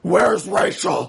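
hoarse, whispered growl; Batman. Retold or imitated. Mocking Briggs storming in, hammed-up Batman rasp. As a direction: rasp it like a hammed-up masked hero. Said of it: "we're doing the recap for Batman".

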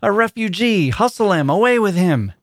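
high, hammered-up mob shouts; crowd shout. A narrator's reading. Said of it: "a general shout burst from the bystanders".

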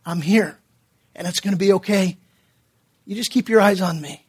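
calm a frightened man in the dark; tender, quiet reassurance from above; reassuring God. Retold or imitated. God reassures frightened pastor at night.

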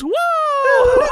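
high, loud cartoon whoa; Elmo. Elmo as his underwear comes off, high loud squeal. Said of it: "and Elmo goes".

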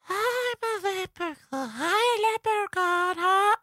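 very high airy whisper of a mock leprechaun; high-pitched whisper. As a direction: imitate the kid's squeaky leprechaun whisper. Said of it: "very high-pitched whisper".

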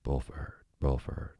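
plain reading voice

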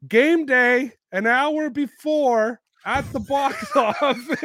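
high, loud, slow, laughing video voice; Boston fan filming his phone video, high and giddy; excited fan. Retold or imitated. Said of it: "he's like".

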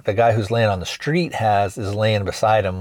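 usual speaking voice

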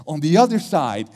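usual speaking voice

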